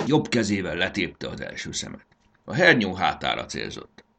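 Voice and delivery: ordinary delivery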